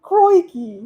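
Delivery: high, loud Aussie exclamation; exaggerated Australian accent; bad Australian accent. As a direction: call it out in a mock-Australian accent. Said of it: "the accent".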